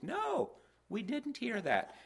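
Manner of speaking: high-pitched mock surprise, drawn out; surprised wife. Wife answering company about the neighbors' move, mock surprised. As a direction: imitate a wife caught unawares, pitch leaping up.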